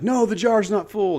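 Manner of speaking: eager, high-pitched class reply; loud, high, excited answer; excited students. Retold or imitated. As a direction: call it out like kids who caught on. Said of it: "they're getting excited now".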